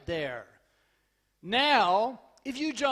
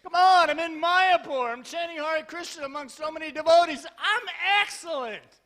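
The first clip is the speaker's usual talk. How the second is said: high, exuberant declaration; rousing, emphatic delight; enthusiastic insistence; 5 semitones higher, 6 dB louder than usual. Acted. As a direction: protest with unbridled enthusiasm. Insists on his own enthusiastic answer.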